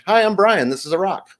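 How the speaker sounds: put-on high, simple little-kid announcement; first-grade kid. First grader at show and tell, childlike. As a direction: lift the opening like a proud schoolboy.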